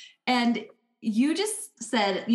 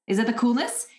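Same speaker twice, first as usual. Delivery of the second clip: ordinary delivery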